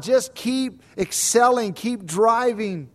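mock coach's exhortation, drawn out; imitated coach yelling. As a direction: push them on like a coach. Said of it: "my coaches in my ear yelling".

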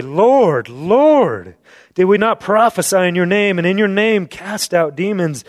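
high, loud desperate cry of Lord, Lord; pleading false believers. Acted. False believers plead with Jesus on that day, high desperate cry. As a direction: beg like someone shut out at the door.

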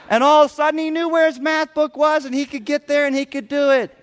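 high, loud delighted payoff; beaming storyteller's punchline; triumphant. Real, not acted. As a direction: celebrate the turnaround.

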